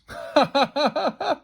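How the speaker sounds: high, bubbly ha-ha laughter; kids' giggling burst; rapid high giggle; fit of giggles. A narrator's reading. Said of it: "fits of giggles from the kids".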